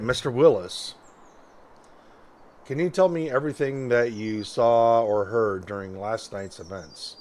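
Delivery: ordinary talk, nothing marked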